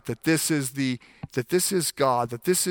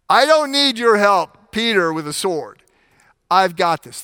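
loud, higher dismissive swagger; cocky attitude; 5 semitones higher, 7 dB louder than usual. Retold or imitated. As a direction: wave off the help with bravado. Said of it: "he doesn't say it with quite this attitude".

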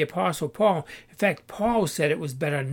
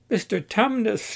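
higher-pitched excited call; mild shout; 6 semitones higher than usual. A narrator's reading. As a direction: call out in recognition, a restrained shout. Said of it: "shouted Pilgrim".

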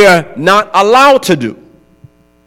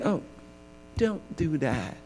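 a gentle, much softer "don't do that"; soft parent hush; 4 semitones lower, 21 dB quieter than usual. Retold or imitated. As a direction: hush a toddler kindly, barely aloud.

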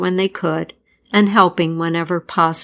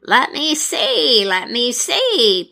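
high, slow, musing; drawn-out pondering voice, raised high; Pesta voice; 5 semitones higher, slower than usual. A narrator's reading. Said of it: "Pesta replied".

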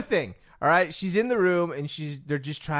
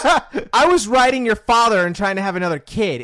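blunt mom; 6 semitones higher, 11 dB louder than usual. Retold or imitated. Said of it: "she goes".

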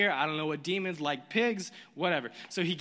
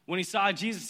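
ordinary delivery

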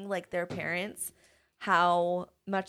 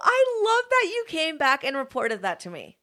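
much higher, louder, gushing delight; touched squeal; 14 semitones higher, 10 dB louder than usual. Retold or imitated. Teacher gushing at a proud student, high squeal.

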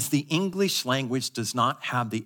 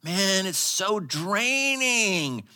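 slow, higher, drawn-out groan of fatigue; weary complaint; 6 semitones higher, much slower than usual. Retold or imitated. Single friend complaining about a sensitive friend. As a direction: drag it out, exhausted by the friend. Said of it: "And you're like".

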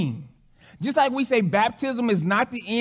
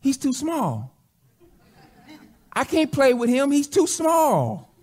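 imitated little boy's letdown, higher and drawn out; mock childish complaint, pitch raised then falling; disappointed child; 4 semitones higher, slower than usual. Boy complaining to his dad about the new baby, disappointed. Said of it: "like, really disappointed".

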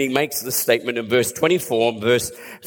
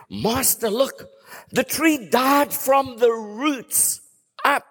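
amazed disciple; 10 semitones higher, slower than usual. Retold or imitated. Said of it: "Peter looks at him and says".

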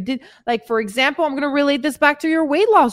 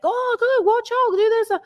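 high-pitched imitation of fussing onlookers; mock the people fretting at you in a high voice; stressful bystanders; 10 semitones higher than usual. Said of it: "stressing me out".

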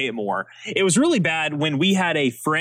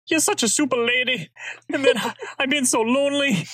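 reenacted Glenn; 7 semitones higher, faster than usual. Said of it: "you crushed the reenactment".

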